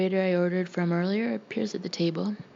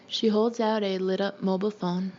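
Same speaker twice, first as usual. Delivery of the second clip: usual speaking voice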